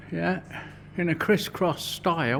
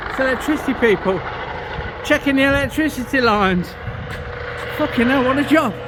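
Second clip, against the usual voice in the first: excited; 6 semitones higher than usual. Real, not acted. Excited by helicopter overhead.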